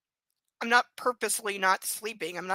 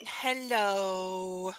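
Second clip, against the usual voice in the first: ordinary talk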